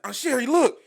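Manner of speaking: put-on high, loud boyish announcement; showing his aunt by the pool, a loud, high, proud kid; proud kid call. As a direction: call out like a proud little boy.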